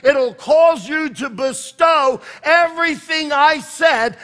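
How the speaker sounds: slow, loud, high building sermon; preaching at full force. Acted. Pastor declaring God's promises to the church, full force preaching. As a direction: drive every promise home, building.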